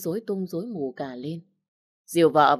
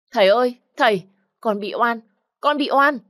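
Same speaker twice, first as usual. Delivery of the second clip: desperate wail, much higher and louder; crying out; 7 semitones higher, 7 dB louder than usual. Acted. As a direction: scream for your father, terrified. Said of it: "nó vẫn gào lên". (gào khóc thảm thiết)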